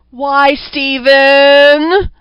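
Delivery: very slow, high, loud falsetto plea. A narrator's reading. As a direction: draw it out in an eerie high falsetto. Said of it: "The strange falsetto had a tinny vibration".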